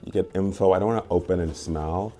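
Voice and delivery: usual speaking voice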